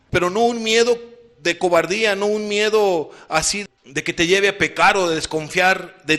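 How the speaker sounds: loud, high-pitched sermon climax; rising fiery pulpit delivery; fervent preaching. Acted. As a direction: preach it out at full force, pressing the congregation. Preaching at full force.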